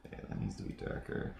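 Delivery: low breathy whisper to himself; whispered aside. Real, not acted. Editor whispering a note to himself, very low. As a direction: mutter the note under your breath.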